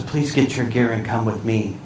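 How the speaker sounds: low, hushed official request; discreet summons. Retold or imitated. Flight attendant quietly fetching a passenger, discreet summons. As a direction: keep it low and soft, for one passenger only.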